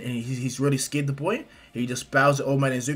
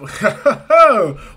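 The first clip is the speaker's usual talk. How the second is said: loud, high booming laugh; hearty hero laugh; 8 semitones higher, 13 dB louder than usual. Acted. Hero delighted by a fan's request, big loud laugh.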